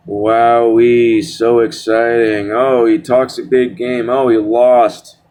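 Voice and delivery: slow, drawn-out fake excitement; sarcastic mock awe. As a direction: stretch it out like you're barely impressed. Mocks a hyped tattooed fighter.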